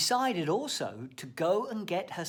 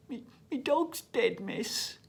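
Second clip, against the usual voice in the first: put-on sad little boy; 7 semitones higher than usual. Child voiced in a joke.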